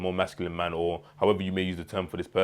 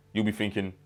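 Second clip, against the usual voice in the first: ordinary delivery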